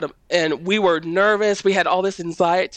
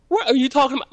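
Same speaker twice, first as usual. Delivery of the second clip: lightly voiced puzzled mom, higher pitch; baffled mother; 8 semitones higher, much faster than usual. Mother answering her disoriented child, mildly baffled. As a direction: imitate a mom's bewildered reply.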